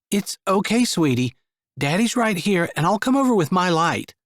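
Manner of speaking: put-on calming a frightened little girl in the dark; soft sweet voice. A narrator's reading. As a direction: soothe a scared child. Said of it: "used the softest and sweetest voice she could manage".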